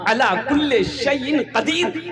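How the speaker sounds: rousing sermon delivery; fervent exhortation. Real, not acted. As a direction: urge the congregation on with conviction. Exhorts congregation with force.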